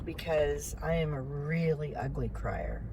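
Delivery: quiet and choked, fighting off tears; holding back tears. Real, not acted. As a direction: speak softly, trying not to cry. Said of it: "I'm trying not to start crying again".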